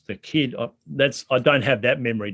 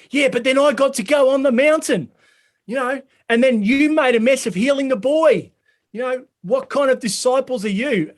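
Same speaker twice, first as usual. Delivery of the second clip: Peter retorting; 10 semitones higher than usual. Acted. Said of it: "And Peter retorts then".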